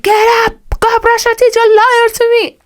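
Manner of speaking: high, loud mock yell of a strict dad. Dad catching kids faking tooth brushing, high mock yell. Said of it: "and he'd be like".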